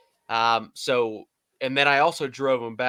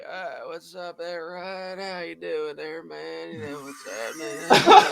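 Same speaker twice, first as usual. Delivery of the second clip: Todd Haley impression; 4 semitones higher, slower than usual. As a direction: do your Todd Haley for the room. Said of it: "give me Todd Haley".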